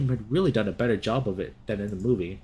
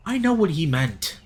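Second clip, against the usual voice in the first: snapping; 6 semitones higher, 4 dB louder than usual. Acted. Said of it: "The fox snapped aggressively".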